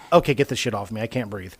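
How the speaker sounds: low, soft, understated plea; retold calm. Pinned worker asking coworkers for help, calm and low. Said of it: "You're so calm".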